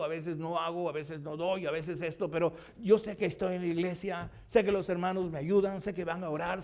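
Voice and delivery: a preacher lifting the room; preaching. Acted. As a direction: preach it up to the congregation. Preaching with rising fervour.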